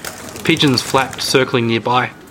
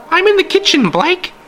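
put-on high, loud old lady calling out; shrill call; 4 dB louder than usual. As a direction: call from another room, shrill. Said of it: "she shouted, her voice almost shrill".

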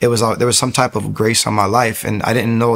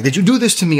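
frightened accusation, much higher; freaking out; 8 semitones higher, faster than usual. Retold or imitated. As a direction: accuse her in a pitched-up panic. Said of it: "I'm freaking out".

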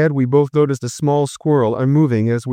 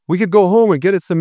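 urging; 6 semitones higher, 3 dB louder, faster than usual. Acted. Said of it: "I urged".

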